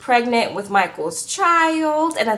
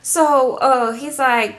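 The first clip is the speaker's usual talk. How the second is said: normal talking voice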